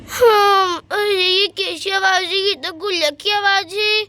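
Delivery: put-on girl's voice, pleading and calling for help; alarmed little girl. Sonia calling after the thief stealing her piggy bank, alarmed. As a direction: call out like a distressed child.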